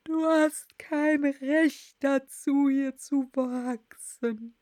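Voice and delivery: very slow, drawn-out creature voice; klagendes Murmeltier. Acted. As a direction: object slowly as a put-upon Dormouse. Dormouse objects to Alice growing. (langgezogene, vorwurfsvolle Murmeltierstimme)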